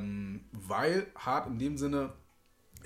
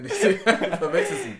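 talking through laughter, getting much louder; laughing; 5 semitones higher, 10 dB louder than usual. Real, not acted. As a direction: laugh your way through the denial, bursting out at the end. Lacht beim Herausreden über den Bruder. (lachend gesprochen, zum Ende deutlich lauter)

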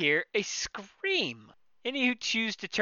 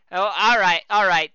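ordinary talk

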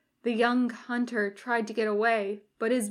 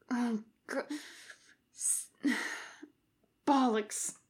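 pained mutter; 6 dB quieter than usual. Acted. Doubled over in pain.

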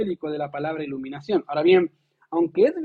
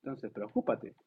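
advertencia en voz baja; 6 semitones lower, 11 dB quieter than usual. Acted. Advertencia final tras larga pausa.

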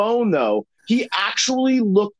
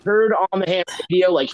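ordinary talk, nothing marked

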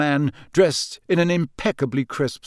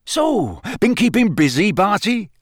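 higher, breezy small talk; breezy Bagman; 7 semitones higher, 5 dB louder than usual. A narrator's reading. Official chatting to a busy colleague, breezy. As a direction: toss the question out breezily. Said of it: "said Bagman breezily".

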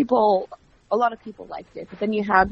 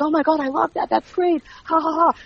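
high-pitched, gushing mock enthusiasm; imitated delight; 9 semitones higher than usual. Host imitates boss loving her joke, delighted. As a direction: act out his delight with a spoken laugh.

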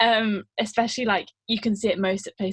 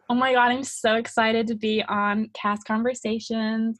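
put-on American accent, excited. Shown as a demonstration. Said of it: "are you able to do, like, an American accent?".

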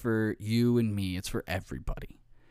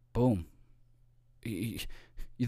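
usual speaking voice

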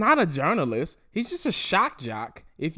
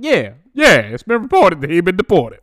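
louder, sing-song gloating; gleeful celebration; 2 semitones higher, 9 dB louder than usual. Retold or imitated. Celebrating the deportation news, loud gleeful gloat. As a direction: gloat with rhyming glee. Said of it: "And I'm like".

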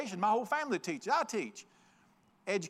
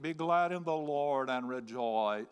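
low, slow, lifeless reading of "Be glad in the Lord and rejoice."; dull minister reading; 6 semitones lower, slower than usual. Shown as a demonstration. Mocking ministers who read the psalm joylessly, droning low and slow. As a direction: drone it like a gloomy preacher. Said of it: "I've heard ministers read it".

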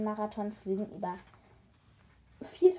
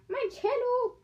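very high alarmed cry; high dismayed cry over her cello; 14 semitones higher, 6 dB louder, slower than usual. A narrator's reading. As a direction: shriek it out in dismay. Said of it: "rief Silvania".

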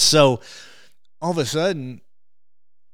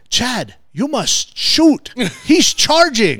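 loud, much higher, slow urgent call; urgent warning; 12 semitones higher, 9 dB louder, much slower than usual. Retold or imitated. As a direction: warn of a charging buffalo. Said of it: "my P H said".

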